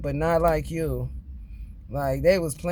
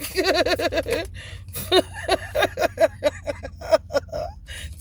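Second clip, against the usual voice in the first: laughing till it cries; helpless high laughter; laughing so hard it squeaks; high crying laugh; 12 semitones higher than usual. Real, not acted.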